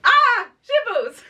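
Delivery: high, slow, giggling curse; cute swearing. Shown as a demonstration. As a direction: squeak out a sweet little swear. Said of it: "can you please go, "Ah, shitballs" for us".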